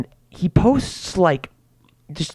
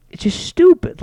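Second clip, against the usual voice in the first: exasperated squeak; 13 semitones higher than usual. Real, not acted. Ranting about social media.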